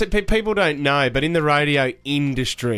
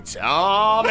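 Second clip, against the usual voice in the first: imitating Whipper; 4 semitones higher than usual. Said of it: "you can hear Whipper every time going".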